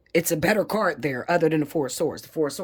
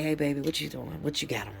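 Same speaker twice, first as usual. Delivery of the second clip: soft sweet-talk voice; 7 dB quieter than usual. Retold or imitated. Voicing affectionate husband call.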